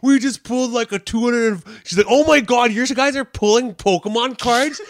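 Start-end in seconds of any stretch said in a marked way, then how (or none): 0.0-1.5 high, loud excited announcement; giddy mock delight; super happy. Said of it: "he's super happy". 2.1-4.8 high, loud mock outrage; berating girlfriend. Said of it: "berating him".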